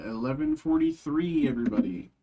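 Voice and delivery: high playful announcement; high sing-song call. Real, not acted. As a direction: call it out high and bright, like a greeting. Announcing time to viewers.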